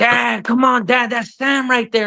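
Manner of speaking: high, pleading child imitation; insistent kid. Son insists to dad that Sam is right there, insistent kid.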